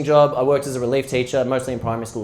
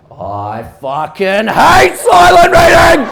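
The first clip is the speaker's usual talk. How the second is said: slow, furious yell, much higher and louder; angry kid shout; 12 semitones higher, 12 dB louder, much slower than usual. Retold or imitated. A kid raging at his teacher about silent reading, drawn-out furious shout. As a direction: explode like a kid who hates silent reading. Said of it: "this one kid just goes".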